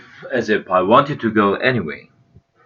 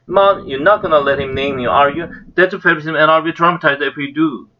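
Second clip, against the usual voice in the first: put-on protesting boy voice; 6 semitones higher than usual. A narrator's reading. Pete protests to mother.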